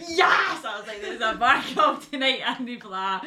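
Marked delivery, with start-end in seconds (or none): 0.1-0.6 high, loud yell of relief; exaggerated celebratory cry; jubilant cheer. Relieved at finding Scottish customers. 1.0-2.4 loud, higher, run-together sales shout; laughing, unguarded loud announcement; excited native-speaker pitch. Retold or imitated. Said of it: "I remember when it was Scottish".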